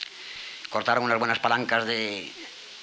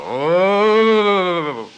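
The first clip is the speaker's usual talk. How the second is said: loud, higher single booming call; bear answering call; 8 semitones higher, 11 dB louder than usual. Acted. Bear answering the fox from Valderodrigo, one loud call. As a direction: boom one long call back like a bear.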